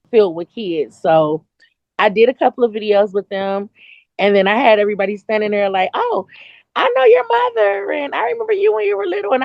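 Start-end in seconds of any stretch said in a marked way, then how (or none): 5.9-9.3 high-pitched gushing voice; hammed-up high fawning; gushing acquaintance. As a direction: imitate an overfamiliar well-wisher. Mimics gushing crowd of adults.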